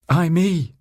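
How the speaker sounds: high-pitched theatrical cry; Juliet's shouted reply. A narrator's reading. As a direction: call it out high, like a stage heroine. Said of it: "shouted Juliet".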